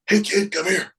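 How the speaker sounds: hammed-up boss impression; rescue man voice. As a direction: call the kid over in the boss's voice. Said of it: "the rescue man voice goes".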